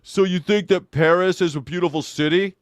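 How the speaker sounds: mock the leading question with a sneer; smug debate bro. Parodying a debate bro's gotcha, sneering. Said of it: "But when you say".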